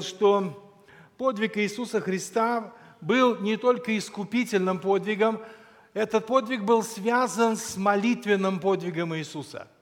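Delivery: usual speaking voice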